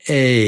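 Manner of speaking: stretched long "ay". Shown as a demonstration. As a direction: hold the "ay" out. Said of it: "But the long one is".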